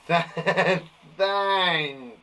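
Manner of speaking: Th- stretched and stressed before thank; emphasized first sound. Shown as a demonstration. Teacher stressing the first sound of thank. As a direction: lean hard on the Th- of thank. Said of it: "I'm gonna emphasize the first sound".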